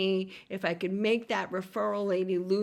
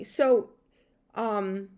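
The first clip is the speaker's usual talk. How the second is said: normal talking voice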